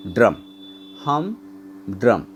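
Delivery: "हम" and "drum" each stressed, set apart by pauses; equal stress on both. Shown as a demonstration. Teacher showing a spondaic foot, both syllables stressed. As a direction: give हम and drum a separate beat each. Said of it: "दोनों में बोलते समय stress आ रहा".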